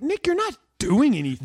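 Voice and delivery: high, loud mock scolding; mock outrage. Podcast host ribbing Nick for slacking, mock outrage. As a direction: lay into him in comic exasperation.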